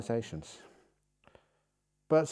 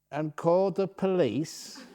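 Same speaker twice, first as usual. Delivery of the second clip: half-laughing, slowed; chuckling while telling the punchline; 5 semitones higher than usual. Real, not acted. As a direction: let the laugh break into the words. Laughing at the funny story.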